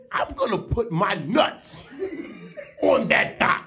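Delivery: theatrical pundit rant with long pauses; Stephen A. Smith. Acted. Pundit dismissing LeBron against Jordan, theatrical impression. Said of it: "Stephen A. Smith, is LeBron James".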